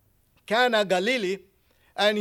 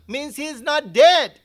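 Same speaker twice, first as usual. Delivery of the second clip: usual speaking voice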